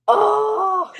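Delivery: loud, high drawn-out groan; exasperated wail. Acted. Lamenting her ruined self-care treat, loud exasperated wail. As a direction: let out a long mock-crying "oh".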